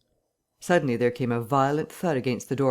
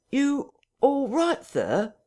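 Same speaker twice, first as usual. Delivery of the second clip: high, slow, sharp call; sharply; 12 semitones higher, much slower than usual. Acted. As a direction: demand an answer sharply through a door. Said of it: "asked Mister Hall sharply".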